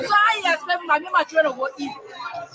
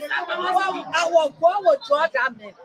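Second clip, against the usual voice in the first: normal talking voice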